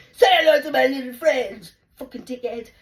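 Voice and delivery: loud, high mock tirade; hammed-up threat. Retelling threatening the man outside, hammed-up shouting. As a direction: bellow an over-the-top movie threat.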